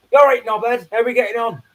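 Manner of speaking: parody of real British greeting. Acted. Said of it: "if they spoke true, it'd be like".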